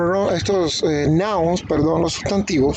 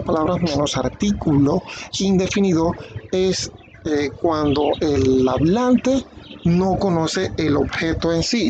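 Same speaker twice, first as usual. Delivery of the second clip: usual speaking voice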